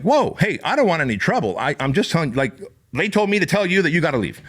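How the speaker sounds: high, hurried "whoa, no trouble" backing off; retold panic, hands-up voice talking her down; nervous placating. Narrator to biker chick, nervously backing off fast and high.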